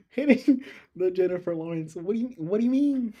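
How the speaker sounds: baffled and giggling at the answer; confused laughing. Real, not acted. Student laughs in confusion at chatbot's answer.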